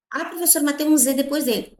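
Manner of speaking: high-pitched pupil's protest; objecting student. Acted. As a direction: pipe up as a student querying the teacher. Teacher voices an objecting student.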